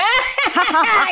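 high cackling laugh; witch cackle. Shown as a demonstration. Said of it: "I've learnt the laugh".

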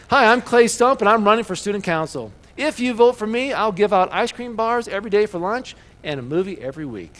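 high and punchy schoolboy speech; Clay Stump. Retold or imitated. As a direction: deliver a kid's big campaign promise. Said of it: "This was his speech".

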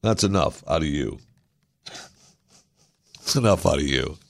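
a hint of a low, stern judge; lightly voiced judge shutting someone down; mildly stern ruling from the bench. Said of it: "I believe that's a quote from the judge".

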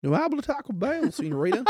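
a quick hammed-up hillbilly twang; hillbilly accent. Shown as a demonstration. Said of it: "in a hillbilly accent".

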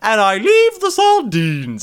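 hammed-up British line; mock British accent. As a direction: ham up a posh Brit. Put-on quote in accent.

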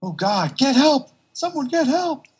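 high, loud cry for help; frantic shout. Retold or imitated. Bystander screaming for help, high and loud.

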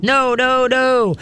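high, sustained mock scolding; mimicked nagging owner. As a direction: nag the dog with high repeated noes. Mimics owners nagging their dogs.

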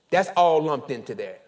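ordinary talk, nothing marked

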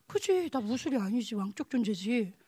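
low, slow self-talk; acted subdued agreement with oneself; quiet realisation. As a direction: let it sink in, softly.

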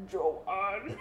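half-hearted attempt at a demonic growl. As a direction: try a throaty demon voice, laughing. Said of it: "Can you do that voice that she does in House Bunny?".

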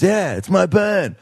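put-on drunk [name]. Drunk singer reveals it's his band, slow and high. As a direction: drag out the reveal like a wasted star. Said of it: "making him sound like a drunk [name]".

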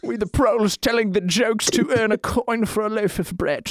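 high, put-on British pauper voice; poor beggar. Improvised poor jesters begging for bread.

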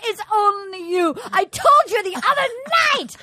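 high, wailing heartbroken suitor; mock crying. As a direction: draw out the last words in a hammed-up lament.

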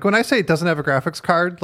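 ordinary talk

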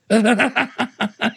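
shrill, broken laugh of terror; high desperate laugh. Acted.

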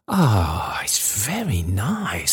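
slow, relishing character voice; savouring old father. A narrator's reading. Father sniffing his dinner basket, slow relish. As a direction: linger over each word with pleasure.

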